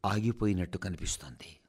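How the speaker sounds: hushed whisper. A narrator's reading. Radio speaker telling the nation, in a hushed whisper. Dramatic retelling of hanging morning.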